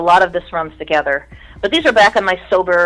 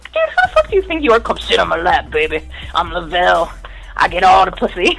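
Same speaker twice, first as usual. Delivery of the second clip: mock outrage into sleazy boast.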